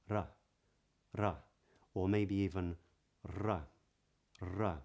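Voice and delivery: ra tapped, tongue tip flicking the roof of the mouth. Shown as a demonstration. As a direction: demonstrate the European tapped ra. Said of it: "your tongue might actually be touching the roof".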